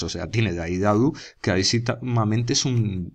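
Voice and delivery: ordinary delivery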